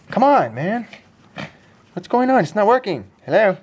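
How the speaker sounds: high, loud coaxing voice to a dog; high dog-talk coaxing; pet talk. Real, not acted.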